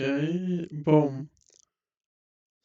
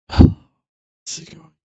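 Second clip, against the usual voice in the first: usual speaking voice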